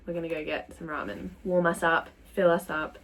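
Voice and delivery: usual speaking voice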